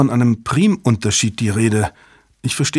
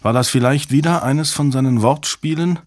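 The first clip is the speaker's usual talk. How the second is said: plain reading voice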